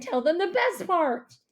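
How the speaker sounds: high, pleading whine; whining. A narrator's reading. Dad begging mom to tell the good news, whining. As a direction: whine it like an impatient child. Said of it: "my dad whined".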